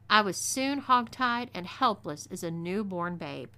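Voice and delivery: plain reading voice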